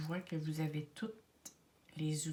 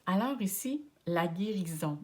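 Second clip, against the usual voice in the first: ordinary talk, nothing marked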